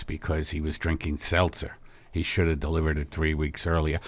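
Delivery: a low, mellow middle-aged husband easing into news; [name]'s low voice. Acted. Husband calling his wife in to break big news, low and soft. [name]'s seltzer history lecture.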